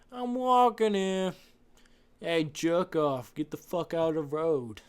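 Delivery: put-on brash New York accent, bellowed at a driver. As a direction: shout it like a New Yorker stuck in traffic. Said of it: "that's how all New York people speak".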